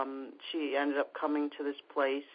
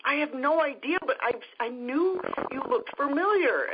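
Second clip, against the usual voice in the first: high, louder retold exclamation; acted-out excitement, pitch raised high; excited amazement; 11 semitones higher, 4 dB louder than usual. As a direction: blurt it out as the amazed stranger. Stranger amazed at the coincidence.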